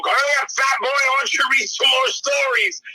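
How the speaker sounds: harry him back to work; hammed-up taskmaster yell; impatient bossy ghost. Ghost bossing the reader.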